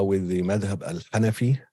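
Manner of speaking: ordinary talk, nothing marked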